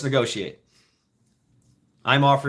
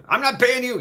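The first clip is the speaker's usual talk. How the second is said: put-on cranky old man; 10 semitones higher than usual. Old man refuses to pay.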